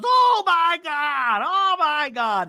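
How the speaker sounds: high, loud, long-drawn wail; hammed-up hysterical exclamation; imitated shrieking. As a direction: screech it slowly in mock outrage. Acts out Alexis's screeched reaction.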